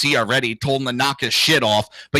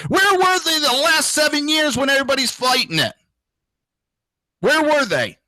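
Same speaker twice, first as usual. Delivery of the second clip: indignant; 11 semitones higher than usual. Real, not acted. Indignant rant at the media.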